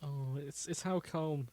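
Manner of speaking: normal talking voice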